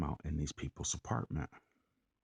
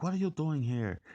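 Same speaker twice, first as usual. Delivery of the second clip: put-on higher, louder challenge in an Irish-ish accent; Irish-sounding accent; 11 semitones higher, 5 dB louder than usual. Man confronting a stranger at his door, mock Irish accent. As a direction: imitate an indignant man with an Irish lilt. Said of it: "he had this accent".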